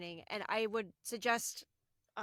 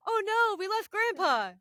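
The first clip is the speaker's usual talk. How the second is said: quoted worry; 8 semitones higher, 6 dB louder than usual. Retold or imitated.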